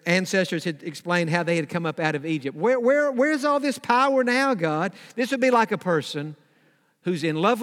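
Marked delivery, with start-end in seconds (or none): 2.5-4.9 higher-pitched, repeated challenge; demanding answers. Acted. Gideon asking God where the power went, demanding.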